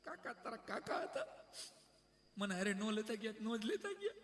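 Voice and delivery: high, soft comic widow's sob; mock weeping. Widowed aunt mourning her husband to a young visitor, mock weeping. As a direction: imitate a wailing aunt, pitch climbing at the end. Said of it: "રોતા રોતા કાકીએ કીધું".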